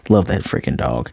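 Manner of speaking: ordinary talk, nothing marked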